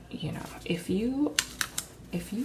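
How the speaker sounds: breathy whispered aside. Real, not acted. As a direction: lean in and whisper it.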